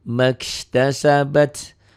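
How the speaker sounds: slow tajwid chant; Quran recitation. Shown as a demonstration. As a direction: draw the words out like a reciter. Recitation example. (lantunan tartil pelan)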